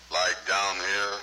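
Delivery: very low, slow voice, pushed down deep. Shown as a demonstration. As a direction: go as low as you can. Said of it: "Get down lower".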